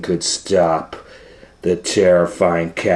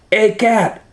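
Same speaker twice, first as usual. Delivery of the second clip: higher mock shout; 11 semitones higher than usual. Limburger taunting the cat from his plane, higher. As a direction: call up to the cat playfully. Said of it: "he shouted".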